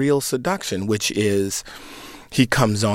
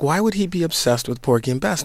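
higher, quicker incredulous question; retold puzzlement; 7 semitones higher, faster than usual. Younger brother questioning older brother about Dad, puzzled. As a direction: re-enact being baffled, pitched up and hurried.

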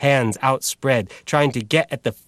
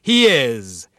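confident declaiming announcer, higher; assured radio announcer; 7 semitones higher than usual. Acted. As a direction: boom it out with growing confidence. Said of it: "His delivery grew more assured now".

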